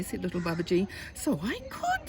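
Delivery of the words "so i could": high-pitched dawning realisation; pleasantly surprised. Acted. Tiger struck by boy's clever idea, high-pitched surprise. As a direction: let the voice leap up as the idea lands.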